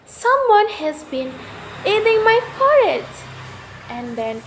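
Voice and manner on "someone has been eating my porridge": much higher, louder mama bear voice; middle-sized mother bear voice; Mama Bear's middle-sized voice. A narrator's reading. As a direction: play the mother bear, pitched up and loud. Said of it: "Mama Bear said in her middle-sized voice".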